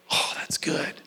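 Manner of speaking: whisper. Real, not acted. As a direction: savor it under your breath. Preacher savors the verse.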